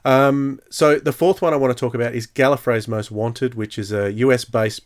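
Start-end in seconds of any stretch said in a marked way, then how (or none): none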